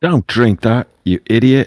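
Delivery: high, loud, exasperated yell; shouted warning. Acted. Player shouts at a thirsty orc by the water, shouted warning. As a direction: shout it out at a careless fool. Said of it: "shout at him if I can see him".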